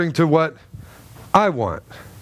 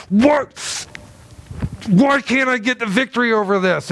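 exasperated cry; 5 semitones higher than usual. Retold or imitated. Frustrated believer crying out.

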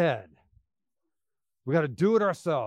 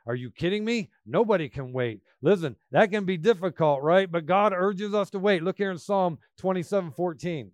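ordinary delivery

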